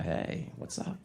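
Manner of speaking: gently interrupt, barely voiced; hushed check-in. Guy pausing the movie to his date, hushed.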